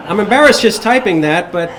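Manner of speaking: giggling while reading aloud; laughing. Real, not acted. Laughing over the anecdote.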